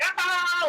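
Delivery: short mock monster growl; wired little monster roar. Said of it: "like a monster".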